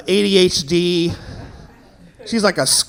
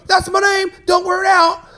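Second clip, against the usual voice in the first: high mock-cocky kid voice; kid's catchphrase; 11 semitones higher than usual. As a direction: sing out the playground comeback. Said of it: "you go".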